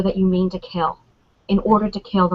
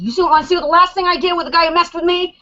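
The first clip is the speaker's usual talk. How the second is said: fast, higher-pitched character voice; Daryl; 8 semitones higher, much faster than usual. Acted. Fan quotes a hothead confronting everyone on first appearance, rapid high impression. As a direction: rattle it off high in his voice. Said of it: "the first time you see Daryl".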